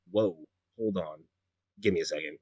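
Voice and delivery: low, soft interruption; taken aback. Acted. Walker stopping an excited gnome mid-greeting, low and subdued. Acted reply to gushing gnome.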